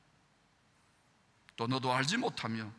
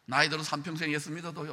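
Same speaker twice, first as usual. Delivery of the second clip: ordinary delivery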